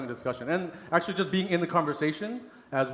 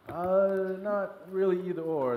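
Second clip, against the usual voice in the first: higher-pitched, drawn-out reluctant answer; hesitant; 4 semitones higher, slower than usual. Retold or imitated. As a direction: retell an awkward, unsure reply. Retold awkward answer to suppliers.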